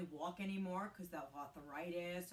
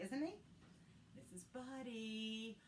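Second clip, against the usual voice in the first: higher, slow sing-song pet talk; 4 semitones higher, 4 dB quieter, slower than usual. Real, not acted. Introducing Buddy the dog to viewers in doting pet talk.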